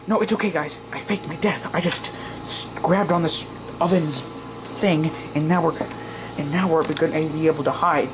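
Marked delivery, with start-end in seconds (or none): none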